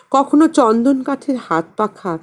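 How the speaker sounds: plain reading voice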